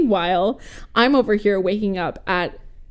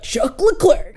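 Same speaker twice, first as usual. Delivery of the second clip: higher-pitched mock dad, laughter creeping in; imitating dad; 7 semitones higher, slower than usual. Daughter retells dad naming the driver, laughing mock-dad voice. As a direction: toss out the name as an amused dad impression. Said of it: "he's like".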